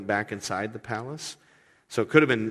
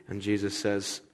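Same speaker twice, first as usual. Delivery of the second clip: normal talking voice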